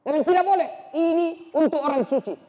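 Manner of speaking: high, loud refusal; firm refusal. Acted. Fatimah refuses Umar.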